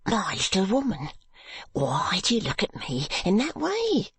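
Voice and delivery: a fretful husband begging his stern wife; pleading timid husband. Acted. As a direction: beg her meekly to stop looking at him.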